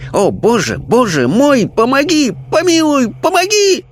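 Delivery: terrified cries. Acted. Possessed medium crying to God for help, terrified. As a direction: beg for mercy in rising panic. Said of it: "крики его были так ужасны".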